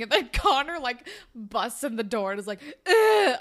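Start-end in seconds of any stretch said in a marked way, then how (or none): 2.8-3.4 loud, high mock groan of angst; angsty groan. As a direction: groan like a sulking, angsty teen. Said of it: "all angsty 'cause of the dance".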